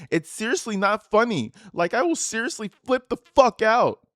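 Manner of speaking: rising, loud mock fury; angry outburst. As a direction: read it like she's about to explode. Daughter's angry text read aloud.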